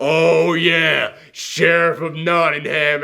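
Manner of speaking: drawn-out, hammed-up big reveal; mock dramatic reveal. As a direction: play it like a grand villain unveiling. Mocks a dramatic villain reveal.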